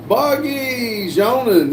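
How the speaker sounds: high, slow, stretched greeting; drawn-out hail. Pretend. Streamer hailing Boggy in chat, drawn-out hail. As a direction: sing out the name high and long. Hails a viewer joining the chat.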